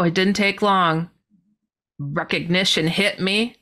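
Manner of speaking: ordinary talk, nothing marked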